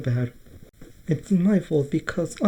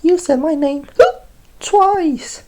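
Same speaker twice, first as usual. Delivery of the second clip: excited high voice; 9 semitones higher, 5 dB louder than usual. Acted.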